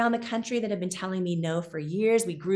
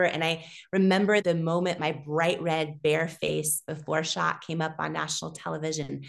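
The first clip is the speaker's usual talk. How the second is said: usual speaking voice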